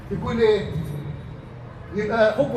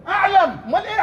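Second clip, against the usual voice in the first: high exclamation; 9 semitones higher, faster than usual. Real, not acted. Preacher's high exclamatory question.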